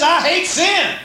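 high, emphatic divine declaration; preacher voicing God's hatred of sin. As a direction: proclaim it as God. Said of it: "God says".

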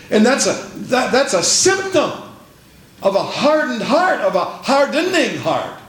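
emphatic sermon delivery, leaning on key words; preaching. Real, not acted. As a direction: preach it out to the congregation. Preacher exhorts congregation, reads scripture.

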